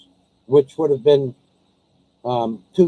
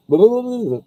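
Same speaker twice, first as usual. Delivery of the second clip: muffled mock talk; 6 semitones higher than usual. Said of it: "and asks me".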